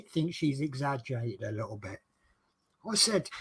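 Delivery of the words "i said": usual speaking voice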